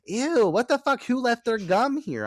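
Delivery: high, loud grossed-out whine; disgusted Kathy. Retold or imitated. Friend imitates the grossed-out roommate, high loud whine. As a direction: squeal it like you found gum. Kathy voiced finding gum.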